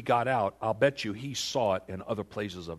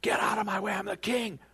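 high-pitched mock royal shout; angry; 9 semitones higher than usual. King pushing past the shocked priests, acted anger. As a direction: play the enraged king ordering them aside. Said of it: "Well, he's angry".

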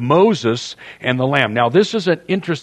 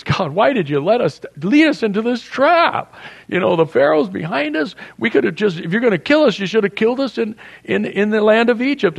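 much higher, whining complaint to God; panicked Moses; 8 semitones higher than usual. Retold or imitated. Moses panicking and complaining to God, high whine. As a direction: plead like a man cornered at the sea. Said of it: "Even Moses starts freaking out".

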